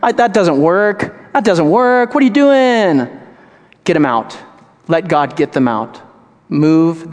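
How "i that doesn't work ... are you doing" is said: higher, scoffing mock voice; mocking friend. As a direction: sneer like a doubting friend. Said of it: "They'll go".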